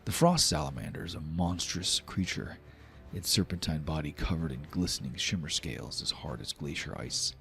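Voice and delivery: ordinary delivery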